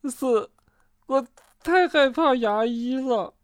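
high, whimpering voice; timid, gap-toothed whimper; frightened confession. A narrator's reading. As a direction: admit your fear like a scared little one. Frightened Bengbeng admits fear.

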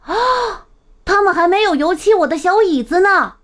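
high-pitched, fast exclamation; crying out loud. A narrator's reading. Peter spots his unpainted little chair, crying out. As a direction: gasp "ah", then exclaim high and quick. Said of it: "彼得大声叫了出来".

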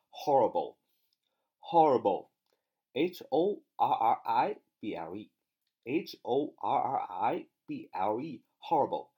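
each letter spaced out, slow; slow spelling drill. Shown as a demonstration. Teacher spelling horrible for the class, slow and careful. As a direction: dictate the spelling deliberately. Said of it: "第四个单词".

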